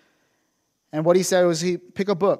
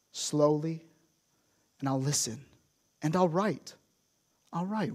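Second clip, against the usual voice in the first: normal talking voice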